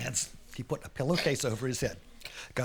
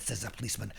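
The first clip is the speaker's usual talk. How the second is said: usual speaking voice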